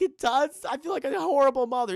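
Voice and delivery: high, loud imitated distress; mock tearful mother. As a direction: whimper loudly as the guilty mother. Crying mother being consoled, acted.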